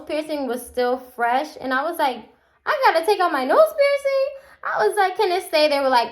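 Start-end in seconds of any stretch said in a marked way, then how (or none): 2.6-5.7 very high-pitched, bougie protest; retold dismayed plea. Told to remove her nose piercing, high dismayed plea. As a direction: shoot up high in mock dismay, then plead.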